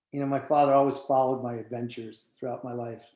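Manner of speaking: ordinary talk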